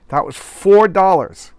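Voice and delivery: slowed mock shock at the price; mock excited outrage. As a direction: ham up the price like a shocked announcer. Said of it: "Shtick is over".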